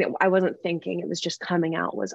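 normal talking voice

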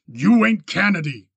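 loud, cutting shout. A narrator's reading. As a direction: cut him off hard. Miles cuts him off.

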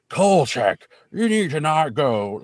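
loud, slurred drunk impression; mock drunk. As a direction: call out slowly like a hammy drunk. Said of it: "I'm a drunk person".

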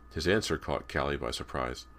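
usual speaking voice

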